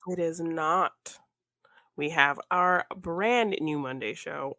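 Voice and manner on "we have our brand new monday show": usual speaking voice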